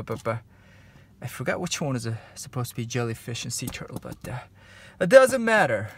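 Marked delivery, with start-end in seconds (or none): 5.0-5.9 loud exclaim. Real, not acted. Forgot which animal, shrugs it off.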